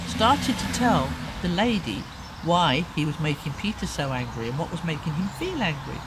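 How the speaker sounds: ordinary delivery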